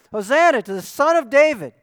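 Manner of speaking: loud, high festive shout; crowd's shout. Retold or imitated. Preacher voicing the crowd greeting Jesus, loud high shout. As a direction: cry it out like the crowd greeting a king. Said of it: "What did they shout?".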